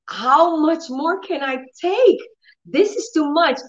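a light cry of exhaustion; mild despair. Retold or imitated. Hiding in the bathroom from pain, a touch of anguish. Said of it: "I said".

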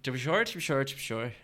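attempted Irish accent; to be sure, Irish style. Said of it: "Go on. Go on".

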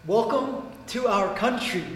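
slow, quiet, deliberate greeting; a stiff, ceremonial welcome to a foreigner; lightly voiced dad bowing and welcoming a guest; dad's mock formal welcome. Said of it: "literally goes like this".

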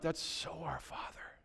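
unvoiced-soft reverent whisper; whispered, intimate realization; hushed awe. Real, not acted. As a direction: drop to a near whisper, moved. Hushed reflection after moving story.

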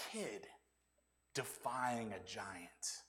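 slow breathy whisper, drawn out; hushed whisper. Acted. Preacher whispers to the congregation, slow dramatic hush. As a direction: lean in and whisper it to the room.

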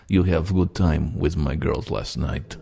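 put-on slow, deliberate Russian accent. Retelling the caller pressing him about last night, slow Russian accent. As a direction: repeat it slowly in a Russian accent. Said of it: "this very convincing accent".

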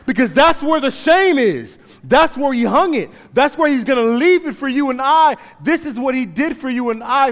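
loud, high, driving sermon voice; full-force preaching. Acted. Preacher urging the congregation to run to the cross, loud and high. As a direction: preach it to the rafters.

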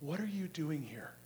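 soft, slow, uncondemning question; gentle fatherly question. Acted. God asks us what we are doing, gentle fatherly question. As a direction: ask it softly, as a father would. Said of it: "It's a question of a father".

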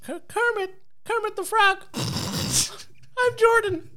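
very high Kermit-like voice; squeaky mock impression, slow and high; Jordan Peterson as Kermit the Frog. Shown as a demonstration. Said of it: "Can you do Jordan Peterson?".

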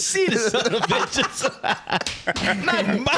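laughing. Real, not acted. Laughing through the words.

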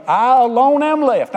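high, loud, drawn-out wail. Retold or imitated. Preacher voicing Elijah crying out I alone am left, mock wail. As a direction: stretch the complaint out slowly like a sulking child. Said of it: "he started whining".

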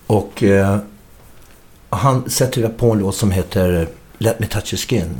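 usual speaking voice

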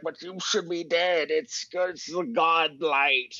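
high, dragged-out mock whine; exasperated protest. Thwarted brewer protests in retold quarrel.

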